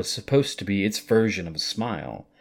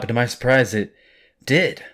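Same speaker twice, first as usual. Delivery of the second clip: ordinary delivery, nothing marked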